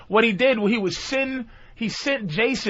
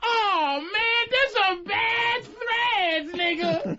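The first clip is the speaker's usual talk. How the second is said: high, slow, drawn-out mock admiration; impressed bystander; 12 semitones higher, much slower than usual. Bystander admiring Superman's costume, hammed-up awe. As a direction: stretch each phrase in comic awe.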